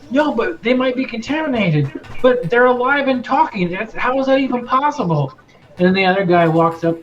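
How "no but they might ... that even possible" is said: high-pitched, baffled arguing; bewildered guard. Acted. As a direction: protest in mild disbelief. Guards argue in disbelief.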